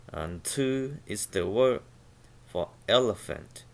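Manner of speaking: ordinary talk, nothing marked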